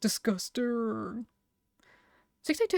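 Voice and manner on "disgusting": slow, drawn-out groan of disgust; groaning monster. Pretend. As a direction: groan the word out like a weary beast.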